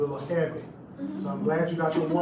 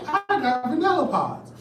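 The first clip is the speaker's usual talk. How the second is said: laughing surprise; 9 semitones higher, 5 dB louder than usual. Retold or imitated. Third take, laughing.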